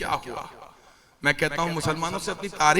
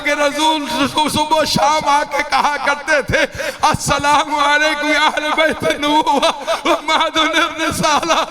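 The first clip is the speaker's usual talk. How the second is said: raised, sustained mourning sermon voice; impassioned lament preaching; 10 semitones higher, 6 dB louder than usual. Acted. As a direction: preach the tragedy at full force, climbing in pitch.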